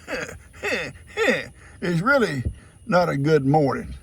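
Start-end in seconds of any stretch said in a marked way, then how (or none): 0.1-1.5 imitating a sulky goat's heh heh; mock grumpy grunt. Papa imitating the goat's rude good morning grunt. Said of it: "to stand out there and go".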